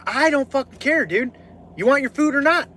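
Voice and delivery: heated, high-pitched and done with it; exasperated defiance. Retold or imitated. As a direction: re-enact challenging an angry customer. Acts out defying the angry customer.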